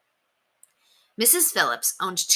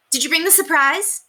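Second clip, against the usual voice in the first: put-on excited boy; 12 semitones higher, 4 dB louder than usual. A narrator's reading.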